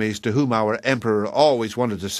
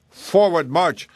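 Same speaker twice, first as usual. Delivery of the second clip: higher-pitched marching command; sergeants' order; 6 semitones higher, 3 dB louder, slower than usual. A narrator's reading. Sergeants ordering the troops on, raised commanding call. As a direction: call it out to the ranks. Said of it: "said the sergeants".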